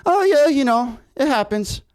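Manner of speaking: high, easy shrug of a reply; mock-casual retelling of the unperturbed roommate; unbothered Italian guy. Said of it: "And the Italian guy's like".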